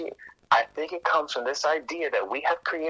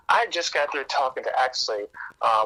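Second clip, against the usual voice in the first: ordinary talk, nothing marked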